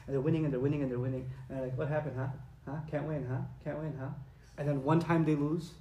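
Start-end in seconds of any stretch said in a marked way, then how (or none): 1.8-4.1 softer, teasing jeer at a loser; mock taunting kid. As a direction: needle the other kid playfully. Kid taunting a losing friend.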